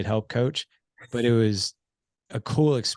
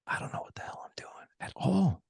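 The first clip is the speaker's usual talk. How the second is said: hushed confession, whispered to his son; whispered admission of knowing nothing; 10 semitones higher, 6 dB quieter than usual. Retold or imitated. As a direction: let him in on a secret in a whisper.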